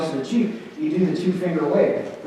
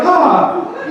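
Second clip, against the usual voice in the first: mock surprised huh; 12 semitones higher, 7 dB louder than usual. Acted waking up next to him.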